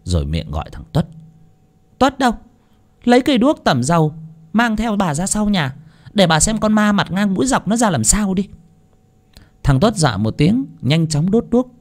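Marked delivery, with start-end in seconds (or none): 2.0-8.5 high, irate mistress giving orders; high-voiced, exasperated command; fed-up wife. A narrator's reading. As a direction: order the servant about crossly. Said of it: "mụ điên máu".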